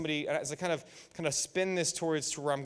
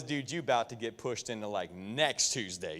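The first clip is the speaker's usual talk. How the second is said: a hint of a tough guy sizing someone up; low inner threat; 6 semitones lower, 6 dB quieter than usual. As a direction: growl the threat to yourself. Inner threat in his head.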